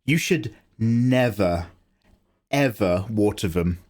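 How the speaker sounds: "never, ever" drawn out slowly with a pause between; stress on never ever. Real, not acted. Streamer correcting plant advice, slow emphatic "never, ever". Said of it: "That's actually a lie".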